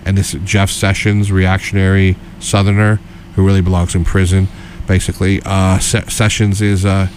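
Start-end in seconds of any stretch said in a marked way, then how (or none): none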